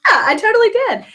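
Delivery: high-pitched, gushing agreement; acted enthusiasm. Customer mirroring the excited consultant, enthusiastic.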